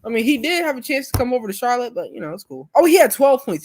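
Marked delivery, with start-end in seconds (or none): 2.7-3.6 raised, louder realization; surprised correction. Real, not acted. Streamer finds out the player scored more, surprised. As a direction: pitch up with sudden surprise at news. Corrects himself on reading the stats.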